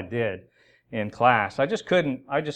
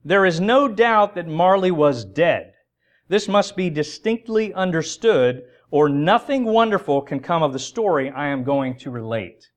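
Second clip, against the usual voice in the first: slow, louder, solemn reading voice; reading Dickens aloud; 5 semitones higher, 6 dB louder, slower than usual. Shown as a demonstration. Preacher quoting the narrator's opening, slow and solemn. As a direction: give the opening line its full weight. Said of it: "he begins by saying this".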